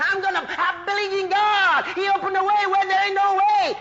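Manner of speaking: loud, high, driving pulpit shout; full-force preaching. Acted.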